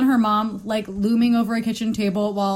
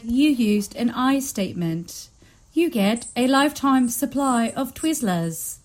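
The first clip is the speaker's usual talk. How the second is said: slow, measured prize announcement; robot host; much slower than usual. Acted. Co-host imagining the robot rewarding a contestant, slow announcement. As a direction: intone it like an automated game host.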